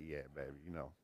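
low, soft, smug mock swagger; a lightly voiced cocky husband; smug dad. Preacher playing cool dad to his wife, low and smug. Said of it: "I'd be like".

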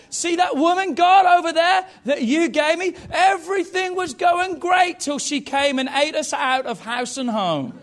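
high, loud blaming cry as Adam; Adam shouting to God that it's the woman's fault; indignant shout. Acted. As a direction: yell the excuse, pointing at her. Adam blaming Eve, voiced.

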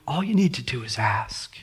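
whispered invitation. Acted. Jesus inviting the church to ask, whispered. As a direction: breathe it out as a gentle whisper.

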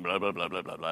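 low blah-blah monotone, lightly mocking; droning politician.

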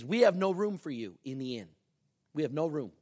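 mildly stern instruction; stern line reading. Retold or imitated. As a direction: lay down the line firmly. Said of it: "says it real sternly".

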